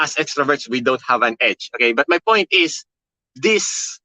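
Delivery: ordinary delivery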